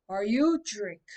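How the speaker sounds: booming soldier's call from behind; loud soldier demanding from behind. A narrator's reading. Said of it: "a loud voice asked".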